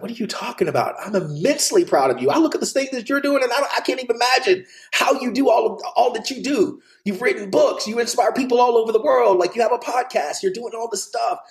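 lightly voiced, excited father; proud father. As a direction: gush like a proud dad. Father's praise retold.

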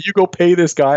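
imitated outburst at a desk clerk; retold yell. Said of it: "I yell at the person behind the desk".

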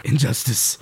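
Batman voice. Retold or imitated. College Humor Batman impression.

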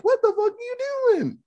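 very high-pitched mock outrage; alarmed counselor. Counselor walking in on the knife, high-pitched alarm. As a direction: imitate a counselor squeaking in disbelief. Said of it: "the counselor comes in. He's like".

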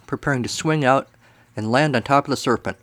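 ordinary delivery

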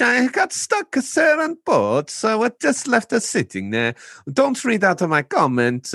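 high, hammed-up Italian accent with a "sir" after every line. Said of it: "he's trying to do an Italian voice as well".

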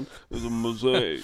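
slow Italian-American Stallone drawl; Rocky impression. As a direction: mumble it like Rocky Balboa. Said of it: "He sounded like Rocky".

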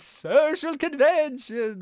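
high, slow, drawn-out villain voice; cartoon villain. Naming the trap Perry can't escape, high villain voice. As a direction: draw it out like a gloating villain.

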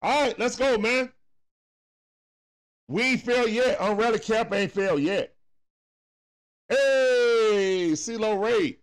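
excited cheering. Real, not acted. Fan cheering his team from the couch, loud and excited. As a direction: pump up the team.

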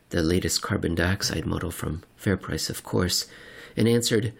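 usual speaking voice

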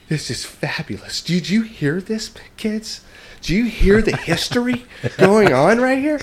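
high, slow, gushing teacher voice; a teacher overjoyed, showing the class; teacher to kids, thrilled and high. Retold or imitated.

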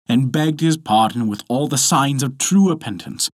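plain reading voice

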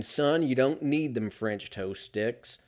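ordinary talk

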